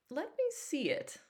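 cautious, guarded request; wary. A narrator's reading. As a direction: ask for it carefully, suspicious. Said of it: "I said warily".

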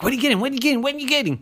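high, pestering squirrel voice; high squeaky pestering; nosy squirrel voice. Acted. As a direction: keep nagging in a high squeak. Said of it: "she'd be like".

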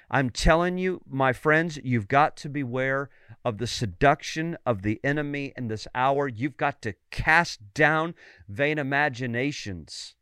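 ordinary talk, nothing marked

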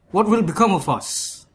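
a touch of fear in a character voice; anxious question. A narrator's reading. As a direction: ask it with mounting unease. Young Persian fears the prophecy.

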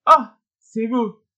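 high, drawn-out cry of recognition; exclaiming. A narrator's reading. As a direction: call out slowly in a lifted pitch. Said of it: "diye haykırdı Glafira Petrovna".

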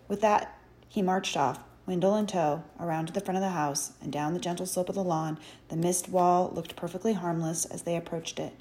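ordinary delivery

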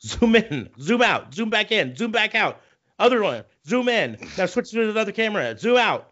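high, urgent camera-call barking; a hammed-up frantic director yelling at the crew; mock director calls. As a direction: rattle off frantic zoom orders like a panicked director.

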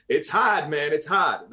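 "hard" as "hahd" in a New England accent; Boston accent. Shown as a demonstration. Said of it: "I juggle that accent".

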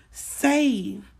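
ordinary delivery

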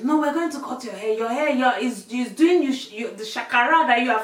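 exaggerated scolding mum voice. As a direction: imitate an exasperated mum telling off her child. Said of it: "She says".

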